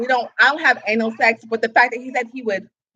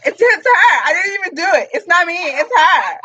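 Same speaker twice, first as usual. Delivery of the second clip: mock protest; 7 semitones higher, 5 dB louder than usual. Jokingly denying blame to the group.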